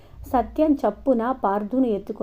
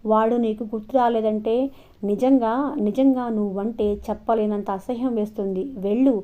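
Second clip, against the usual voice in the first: plain reading voice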